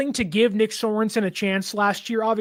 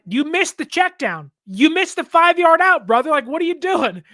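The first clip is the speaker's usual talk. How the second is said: higher, louder scolding; mock scolding coach; 7 semitones higher, 5 dB louder than usual. Coach Kyle scolds Mac for missed throws, loud and exasperated. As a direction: chew out the quarterback for blown reads. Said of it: "Kyle's like".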